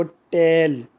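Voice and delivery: टेल said alone, given full weight; stressed syllable shown alone; stressed word. Shown as a demonstration. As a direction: isolate टेल and lean on it. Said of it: "उसको ज़ोर दिया जा रहा है एक word को".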